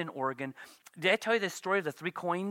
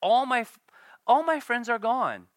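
higher, louder lament; anguished cry of loneliness; lonely Job; 6 semitones higher, 6 dB louder than usual. Acted. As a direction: wail it like a forsaken man. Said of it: "Here Job is just".

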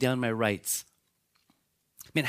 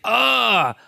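jailer's panicked cry; 7 semitones higher, 6 dB louder than usual. Retold or imitated. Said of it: "he's like".

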